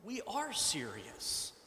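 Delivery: soft, slow, gentle answer; calm the angry man down softly; calm reply. A narrator's reading. Congregation answering the angry chair, soft and calm.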